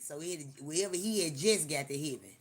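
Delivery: ordinary talk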